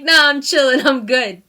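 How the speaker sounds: loud, laughing mock nonchalance; laid-back shrug. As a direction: toss it off as if nothing matters, still laughing. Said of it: "and you're like".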